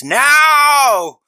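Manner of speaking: loud, high drawn-out yell; retold scream. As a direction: belt it out in one long cry. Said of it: "he just screams".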